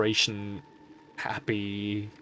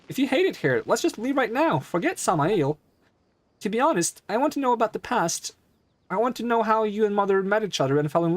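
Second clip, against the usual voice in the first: put-on much higher pleading girl voice; a daughter begging her father; young girl voice; 9 semitones higher, faster than usual.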